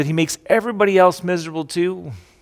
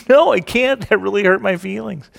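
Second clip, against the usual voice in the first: mock wounded reply; 6 semitones higher, 4 dB louder than usual. Mocking hurt reply.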